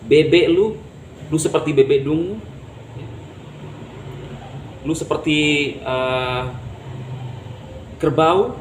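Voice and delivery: hinaan kasar kepada pasangan, sedikit lebih keras; mengejek pasangan. Pretend. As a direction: ejek pasangan seolah sedang kesal. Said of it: "mengata-ngatai pasangan Anda". (suami mencela istri dengan nada menghina)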